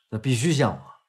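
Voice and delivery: ordinary talk, nothing marked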